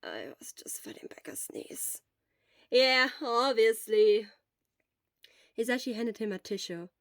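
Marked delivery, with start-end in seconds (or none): 0.0-2.0 low whispered grumble; grumbled excuse. Acted. Shota grumbling an excuse at grinning Hisashi, whispered. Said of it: "he grumbled". 2.7-4.3 teasing disbelief. Acted.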